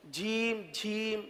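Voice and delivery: جھیم with a breathy puff after the j, said softly; wrong aspirated jheem. Shown as a demonstration. Teacher shows the common error, a soft breathy جھیم. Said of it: "اس کو جھیم پڑھتے ہیں".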